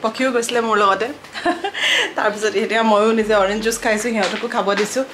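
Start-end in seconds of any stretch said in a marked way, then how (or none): 1.1-2.1 brief high laugh. Real, not acted. Laughing about the school run.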